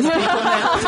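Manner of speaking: high, giggly joke; laughing. Real, not acted. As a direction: laugh while warning. Joking about rebooking customers.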